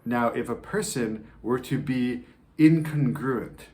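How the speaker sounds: usual speaking voice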